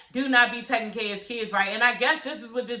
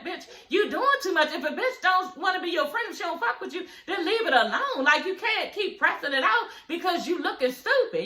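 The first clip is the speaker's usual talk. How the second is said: higher-pitched mock telling-off; retold scolding; 8 semitones higher than usual. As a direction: imitate a friend setting someone straight, pitched up. Retells Shekinah scolding Carly Red.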